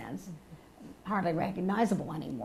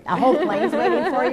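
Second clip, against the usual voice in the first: loud, high, laughing question; amused disbelief; 8 semitones higher, 10 dB louder than usual. Real, not acted. Host ribbing a panelist about a waiting plane, laughing incredulously. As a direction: tease him with a raised, laughing voice.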